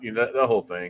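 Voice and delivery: ordinary talk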